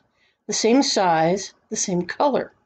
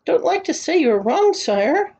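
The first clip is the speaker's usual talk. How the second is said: deferential, higher-pitched voice; very polite; 7 semitones higher than usual. A narrator's reading. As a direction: contradict the king meekly and politely. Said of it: "said Bartholomew very politely".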